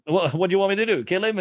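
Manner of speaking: incredulous bar guy. Retold or imitated. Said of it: "he's like".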